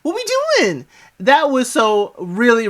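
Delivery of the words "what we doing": high, fast giddy disbelief; excited Julie. Retold or imitated. Quoting Julie's giddy shock at the proposal, high and fast. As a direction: squeal it out like she can't believe it. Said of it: "she's like".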